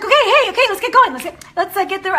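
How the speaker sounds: imitating a late client eager to get going; rushed chirpy hurry. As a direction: brush past the lateness, all bustle. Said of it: "she's like".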